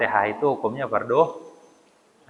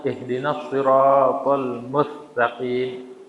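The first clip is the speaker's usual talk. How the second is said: slow chanted "siratal mustaqim"; slow tajwid recitation; Quran recitation; much slower than usual. Shown as a demonstration. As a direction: recite it slowly like in prayer. Recites Fatihah verse.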